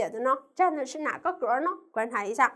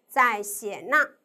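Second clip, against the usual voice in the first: ordinary talk, nothing marked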